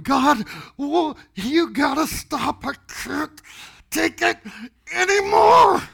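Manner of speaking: high, halting cry rising to a scream; desperate plea. Retold or imitated. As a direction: act out begging, broken into bursts, ending in a yell. Re-enacting begging God to stop.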